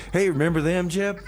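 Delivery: ordinary delivery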